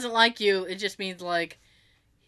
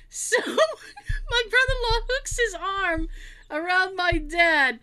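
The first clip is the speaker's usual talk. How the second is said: high, drawn-out words breaking into laughs; helpless laughter; 12 semitones higher, slower than usual. Real, not acted. Telling how brother-in-law pulls dad backwards, helplessly laughing. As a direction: fight to keep telling it through real giggles. Teller overcome by her own story.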